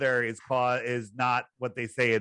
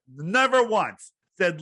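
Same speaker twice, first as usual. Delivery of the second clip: ordinary talk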